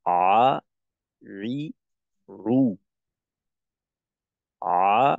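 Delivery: আ, রি, রু with a throaty ain, vowel by vowel; Arabic ain drills. Shown as a demonstration. Teacher models the ain sound for students, throaty and deliberate. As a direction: sound each ain syllable from the throat, clearly apart. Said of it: "আইনের উচ্চারণটা এইভাবে করব আমরা".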